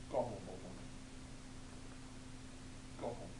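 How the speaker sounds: low, soft final dismissal; low quiet stern fish. Acted.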